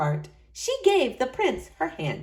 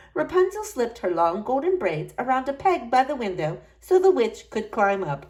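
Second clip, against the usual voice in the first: ordinary delivery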